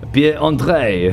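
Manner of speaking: slow, high mock French accent. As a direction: roll out the name in a hammy French accent.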